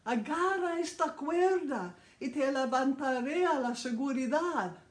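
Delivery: high, loud shout over the rotor noise; pilot's loud high call. Retold or imitated. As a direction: yell the offer down from above. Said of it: "el piloto gritó".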